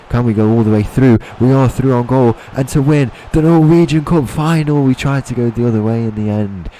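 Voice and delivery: excited shout. Real, not acted. Commentating his own breakaway in the cup final, excited shout. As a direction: voice climbing high and loud as the chance opens.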